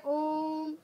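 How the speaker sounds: ओम with the ओ held very long and slow; drawn-out Om. Shown as a demonstration. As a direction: stretch ओम to triple length. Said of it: "किसमें तिगुना समय लगता है". (ओम को खूब लंबा खींचकर बोलें)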